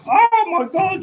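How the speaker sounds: imitating the crystal shop lady's amazed shriek; shocked shop lady. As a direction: squeak it out like she's seen a ghost. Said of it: "she was like".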